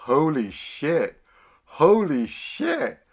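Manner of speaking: slow, drawn-out stunned repeats; stunned awe. Retold or imitated. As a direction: let each one sink in slowly. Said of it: "Dan Hidea's like".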